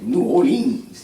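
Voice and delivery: "New Orleans" stretched out slowly and sung up high; New Orleans accent. Retold or imitated. Mimicking how they name the city, drawn-out New Orleans accent. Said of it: "They be like".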